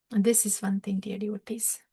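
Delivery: hushed confiding whisper. Real, not acted. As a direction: lean in and whisper the secret. Confiding a key point to devotees.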